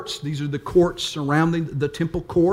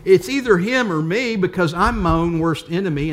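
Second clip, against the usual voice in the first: usual speaking voice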